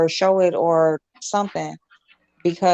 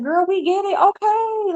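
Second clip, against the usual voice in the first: much higher, sassy dismissive quote; exasperated; 13 semitones higher, slower than usual. Retold or imitated. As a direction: cut her off with a high sassy brush-off. Quoting exasperated cut-off.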